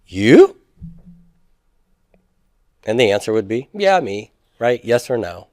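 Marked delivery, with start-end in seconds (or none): 0.0-0.6 you? rises sharply and louder at the end; rising question. Shown as a demonstration. Teacher showing question intonation to students, rising tune. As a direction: ask you? with a strong upward lift. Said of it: "raising the intonation".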